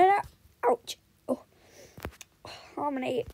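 usual speaking voice

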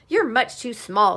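loud, cutting put-down; snapped. A narrator's reading. Cat scolding the little duck, snapped. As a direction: snap it out loudly. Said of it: "snapped the cat".

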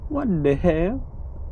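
high, drawn-out disbelief; incredulous exclamation. Real, not acted. Gamer reacts to the horror game, incredulous. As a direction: stretch it out high, rising at the end.

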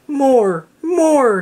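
high, squeaky demands; demanding. A narrator's reading. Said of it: "demanded the little face".

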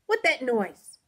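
startled, loud sleepy alarm; jolted-awake fright; frightened. A narrator's reading. Guest wakes frightened in story.

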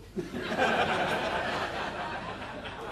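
high breathy cackle of laughter; long helpless laugh. Real, not acted. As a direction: crack up at the punchline. Laughing at own joke.

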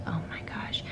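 breathy, drained "oh my gosh" under the breath; whispered frustration; exasperated whisper. Retold or imitated. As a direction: sigh it out, at the end of your rope. Overwhelmed mom, inner exasperation.

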